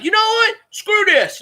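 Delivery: high mocking imitation of a pundit; mock outburst. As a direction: flip from apology to a sneering about-face. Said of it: "Then he's like".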